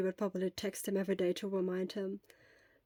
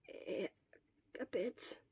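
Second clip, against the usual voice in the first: much softer, tired mumble; barely audible, drained of energy; faint weak reply; 12 dB quieter, slower than usual. Acted. Sick boy answers the nurse very faintly.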